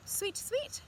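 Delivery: put-on sing-song bird call for the children; bird chirp. Bird voiced in picture book.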